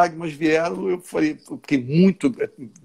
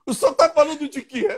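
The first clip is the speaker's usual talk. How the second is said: laughing; 11 semitones higher than usual. Real, not acted. Quoting flight attendant through laughter.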